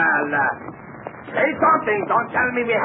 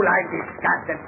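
ordinary delivery

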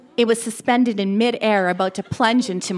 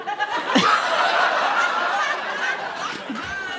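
breathless laugh; 12 semitones higher than usual. Acted. Dying narrator laughs in blood.

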